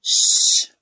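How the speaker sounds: loud, drawn-out shh; forceful unvoiced hushing sound; long shush. A narrator's reading. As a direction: hiss for silence in a theatre. Shushing in theatre audience.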